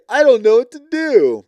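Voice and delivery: high, whiny voice, hammed-up tears; mock crying. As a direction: imitate her helpless sobbing. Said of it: "she's like".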